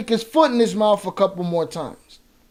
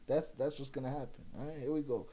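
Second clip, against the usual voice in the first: 1 muttered aside; 7 semitones lower, 20 dB quieter, faster than usual. Real, not acted. Muttered aside after laughing.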